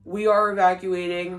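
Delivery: loud, emphatic emergency announcement. Retold or imitated. MC clearing the crowd before the storm, announcing loudly. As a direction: imitate an MC projecting to a crowd. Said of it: "the M C came back".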